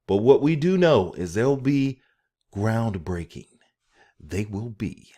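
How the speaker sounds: booming, dramatic trailer announcer; theatrical voice. Acted. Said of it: "I wanna do my theatrical voice too".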